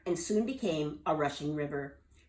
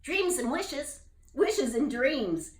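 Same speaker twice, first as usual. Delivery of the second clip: slow, knowing bullfrog voice, higher; with a wink; 8 semitones higher, 4 dB louder, slower than usual. Acted. Jubal echoing the wizard with a wink. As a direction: wink as you say it. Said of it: "Jubal said with a wink".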